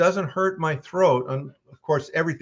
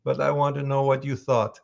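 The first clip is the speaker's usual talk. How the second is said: normal talking voice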